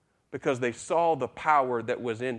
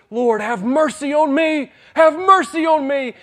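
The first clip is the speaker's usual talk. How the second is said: high, loud desperate plea; crying out for mercy; 13 semitones higher, 10 dB louder than usual. Retold or imitated. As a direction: call out like a blind beggar begging. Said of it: "he cries out and says".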